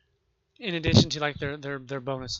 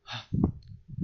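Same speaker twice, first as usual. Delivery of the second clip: heavy exasperated breath out; frustrated sigh. Real, not acted. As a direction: let out a fed-up sigh. Fed up with his own stumbling.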